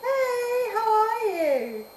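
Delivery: very high, drawn-out hello; delighted greeting. Real, not acted. Streamer welcoming an arrival in the chat, delighted greeting. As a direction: sing out a long, high greeting.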